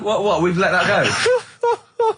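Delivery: slow, high, laughing through the words; can't believe it, cracking up; incredulous laugh-cry; laughing disbelief. Real, not acted. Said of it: "laughing disbelief".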